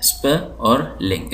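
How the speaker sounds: स्प and लिंग each set apart, slowly; separate syllables. Shown as a demonstration. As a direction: isolate the two pieces, स्प, then लिंग. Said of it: "इसके अंदर दो syllable हैं".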